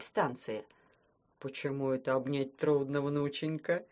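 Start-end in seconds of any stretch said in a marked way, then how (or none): 1.4-3.8 put-on puzzled old man. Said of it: "удивился Дед Мороз".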